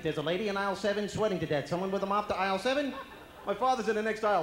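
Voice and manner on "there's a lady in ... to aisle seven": 1 store announcement. Retold or imitated. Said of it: "There'd be an announcement".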